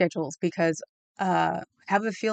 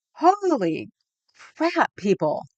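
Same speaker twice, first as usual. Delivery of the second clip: excited exclaim; 8 semitones higher, slower than usual. Retold or imitated. Retelling amazed reaction to crowd.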